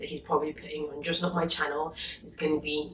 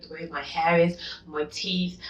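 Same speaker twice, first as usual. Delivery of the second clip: ordinary talk, nothing marked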